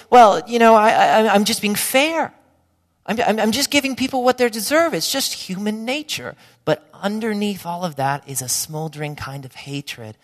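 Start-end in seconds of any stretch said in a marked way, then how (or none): 0.1-6.4 imitating a defensive person, a bit louder and higher; hammed-up stammering excuse of fairness; self-justifying excuse. Preacher voicing a defensive person justifying hatred, mock indignant. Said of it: "they'll say".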